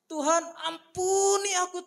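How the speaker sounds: high, loud cry for mercy; anguished cry. Pretend. Sinner crying to God for mercy, high and loud. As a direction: cry out for forgiveness.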